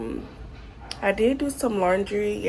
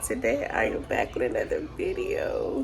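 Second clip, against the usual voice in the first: hoarse sick voice. Real, not acted. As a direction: talk through a sore, hoarse throat. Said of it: "as you can hear, I'm still kinda sick".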